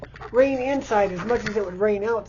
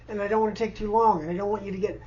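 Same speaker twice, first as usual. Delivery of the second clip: usual speaking voice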